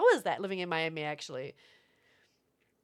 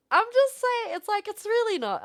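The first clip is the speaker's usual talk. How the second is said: excited protest; 13 semitones higher, 8 dB louder than usual. Real, not acted. Defending herself to chat.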